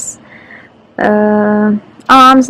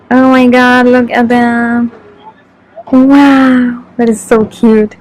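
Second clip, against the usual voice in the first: ordinary delivery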